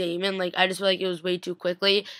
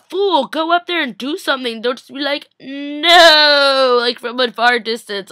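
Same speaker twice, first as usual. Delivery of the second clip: higher, louder exasperated scolding; 6 semitones higher, 7 dB louder, slower than usual. Pretend. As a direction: tell him off for doing nothing. Scolding Tyler at the screen.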